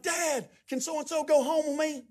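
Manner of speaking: high-pitched imitation of a child asking; pleading kid. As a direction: beg Dad in a kid's voice. Child asking dad, acted.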